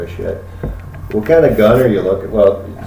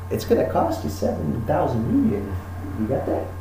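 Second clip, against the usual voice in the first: hushed dealer; 9 dB quieter than usual. Acted. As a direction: murmur the shady deal. Dealer offers illegal gun.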